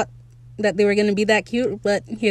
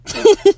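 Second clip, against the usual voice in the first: burst of laughter; 11 semitones higher, 8 dB louder than usual. Real, not acted. Cracks up at Frenchie's fall.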